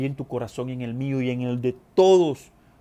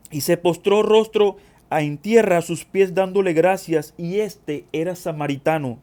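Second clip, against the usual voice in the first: raised, emphatic Bible reading from the pulpit; declamatory scripture reading, punching key phrases; 3 semitones higher, 6 dB louder than usual. Acted. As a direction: proclaim the passage to the congregation. Reads scripture aloud to congregation.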